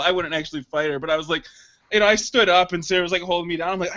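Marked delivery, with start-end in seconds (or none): none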